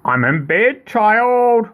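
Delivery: lightly voiced old lady, rising high on child; wolf faking granny. Wolf pretending to be grandma, lightly voiced old lady. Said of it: "in his best old lady voice".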